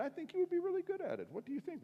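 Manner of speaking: high-pitched, quick enthusiasm; eager pitch. Retold or imitated. Customer offering deli worker a job, eager.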